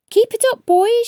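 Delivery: a teacher's bright encouragement with a smile; teacher calling across the playground, smiling. A narrator's reading. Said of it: "she said with a smile".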